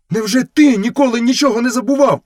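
high-pitched, challenging wolf voice; interrupting retort. A narrator's reading. Wolf cutting Mowgli off, a high indignant retort. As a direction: cut in with a high, pointed question. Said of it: "обірвав його сірий брат".

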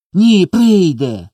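high, loud, stretched-out protest; insistent child. A narrator's reading. Small boy insists mama will come, stubborn. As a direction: contradict him slowly and high.

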